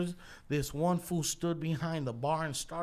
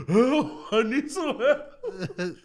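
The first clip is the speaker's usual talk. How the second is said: higher, louder, slow hammed-up cry for help; mock plea for help; 9 semitones higher, 7 dB louder, much slower than usual. No Shame imitating Hollow carrying the body, a hammed-up cry for help. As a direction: ham up a desperate plea.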